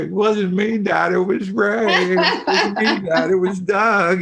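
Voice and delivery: put-on high-pitched, drawn-out kid protest through laughter; whining twins. Twins telling Dad he yelled at the wrong one, whining twins. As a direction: imitate kids whining, stretching the names. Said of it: "And finally, they would go".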